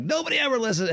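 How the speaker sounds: high-pitched mock complaint of an annoyed wife. Husband imitating his annoyed wife, high and whiny. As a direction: mimic a fed-up wife, voice jumped up high. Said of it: "Sometimes I get a little annoyed".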